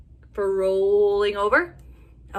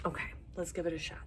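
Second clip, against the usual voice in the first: plain reading voice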